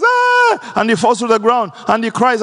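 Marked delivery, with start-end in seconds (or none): 0.0-0.7 sudden high cry of despair; a sharp anguished "Ah!" much higher; Moses crying out. Retold or imitated. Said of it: "And Moses goes".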